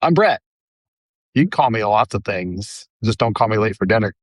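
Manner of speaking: usual speaking voice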